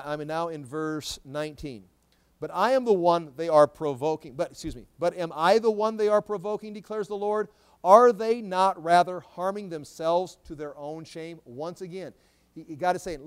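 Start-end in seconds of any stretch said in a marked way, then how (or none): 2.4-4.3 loud, raised-pitch Bible proclamation; forceful scripture reading. Acted. Reads scripture aloud to congregation. 5.0-11.4 loud, raised-pitch Bible proclamation; forceful scripture reading. Acted. Reads scripture aloud to congregation.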